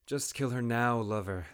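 called out. A narrator's reading. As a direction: call to him across the tent. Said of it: "the exotic woman called".

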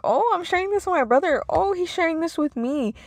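bright rush of retold wonder at sharing; imitating her own giddy surprise; excited surprise. Recalling delight at bonding with brother.

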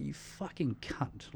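slow, soft fuming curse; under-breath curse. Retold or imitated. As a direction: mutter it at the patient. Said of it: "you're sitting there fuming".

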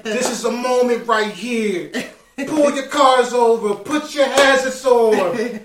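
loud high radio DJ hype; [name] hype. Pretend. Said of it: "I'll be [name]".

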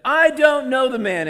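very high, loud outburst; sworn denial. A narrator's reading. Peter cursing and swearing to them, shouted denial. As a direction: shout it as a furious oath. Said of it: "and he swore to them".

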